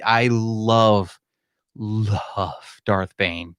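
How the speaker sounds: very slow, drawn-out love; savoring emphasis. Real, not acted. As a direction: linger on each "love" like a devoted fan. Gushing over favorite book trilogy.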